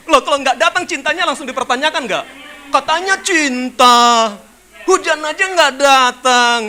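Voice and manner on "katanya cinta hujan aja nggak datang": slow, much louder, drawn-out scolding; mocking reproach. Real, not acted. Preacher mocking a lover who skips the date, slow and loud. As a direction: drag it out with teasing scorn.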